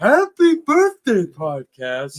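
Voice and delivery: high, slow cartoon snowman; drawn-out snowman voice; Frosty the Snowman voice. Repeats the Frosty bit.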